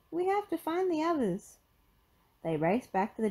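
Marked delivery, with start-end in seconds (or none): 0.1-1.6 high, urgent cartoon voice; high-pitched sidekick in a hurry; Shaggy calling out. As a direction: urge your pal along in a high voice. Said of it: "shouted Shaggy".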